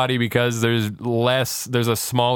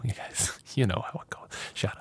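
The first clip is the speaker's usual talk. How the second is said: hushed joking whisper; 11 dB quieter than usual. Real, not acted. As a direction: drop to a whisper, teasing. Joking aside about partying with girl.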